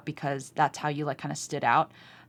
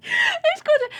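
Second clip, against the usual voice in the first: shocked squeal; 6 dB louder than usual. Pretend. Quoting lyric in shocked high voice.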